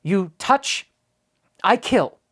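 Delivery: a touch of a fierce tiny warrior's growl; mild threatening growl; growled ferociously. A narrator's reading. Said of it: "the Indian growled ferociously".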